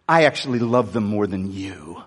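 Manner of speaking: low, gentle rebuke; God's rebuke. Retold or imitated. God rebuking the pastor, low and gentle. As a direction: gently set him straight. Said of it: "I felt this rebuke".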